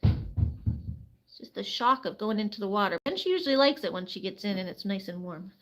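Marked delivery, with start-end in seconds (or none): none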